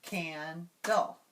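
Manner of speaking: "Candle" split as can, dle; divided into syllables. Shown as a demonstration. Students joining the teacher's clapping, syllable by syllable. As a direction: say "Candle" in two separated beats. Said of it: "do that same thing".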